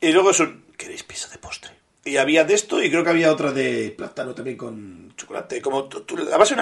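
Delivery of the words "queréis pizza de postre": softly whispered quote, much quieter; hushed retold question. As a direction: breathe the offer like a secret. Retells waiter offering dessert pizza.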